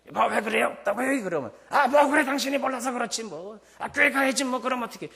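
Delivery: a spouse who rules the house snapping back; dismissive spouse. Retold or imitated. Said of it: "이럴 것이 아니라는 거예요".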